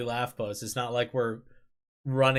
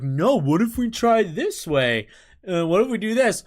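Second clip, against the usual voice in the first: higher, louder mock-suggestion voice; exaggerated, high-pitched mimicry; mocking teammates; 7 semitones higher, 6 dB louder than usual. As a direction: imitate an annoying teammate piping up with ideas. Mocks teammates' bad suggestions.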